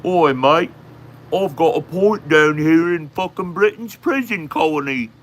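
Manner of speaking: loud, high, hammed-up Aussie accent; mock Australian bogan. Streamer mocking an Australian chatter with a point to make, loud Aussie accent.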